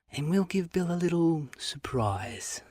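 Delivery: conspiratorial whisper, slow; sly slow whisper behind the door. Acted. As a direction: breathe it so Bill won't hear.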